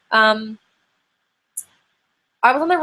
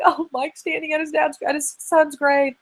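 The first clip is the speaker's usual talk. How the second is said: retold crying; 7 semitones higher than usual.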